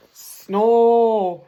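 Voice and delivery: big mock snore, loud. Teacher reads to class, loud snoring. Mrs Bear's snoring in story.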